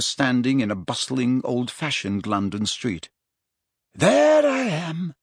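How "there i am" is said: high, drawn-out cheerful announcement; bright. A narrator's reading. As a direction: point yourself out brightly, stretching the words. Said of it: "said Dumbledore brightly".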